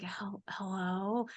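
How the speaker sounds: a faint, slow, sleepy whispered hello; drowsy half-asleep whisper. Retold or imitated. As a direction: whisper it like you were just woken up. Groggy person woken at 3am.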